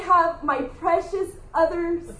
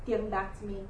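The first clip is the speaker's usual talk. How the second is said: soft voice of God; 5 semitones lower, 10 dB quieter, faster than usual. Retold or imitated. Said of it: "And then he said".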